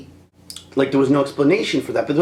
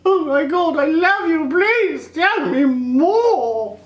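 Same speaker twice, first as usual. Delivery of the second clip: much higher, slow, breathless adoring voice; swooning Padmé; 14 semitones higher, much slower than usual. Retold or imitated. Vlogger mocks Padmé swooning over Anakin's sand talk. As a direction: gush like a lovestruck girl.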